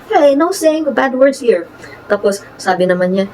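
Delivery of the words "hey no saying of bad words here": higher, slower, gently friendly; nice way. Retold or imitated. Telling the cursing engineer to stop, nicely. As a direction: soften the rebuke so it sounds kind. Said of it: "but I said it in a nice way".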